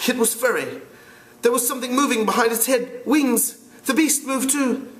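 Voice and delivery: put-on high boyish voice, breathless with fear; a scared boy blurting out what he saw; frightened twin boy. Twins telling the assembly about the beast, frightened.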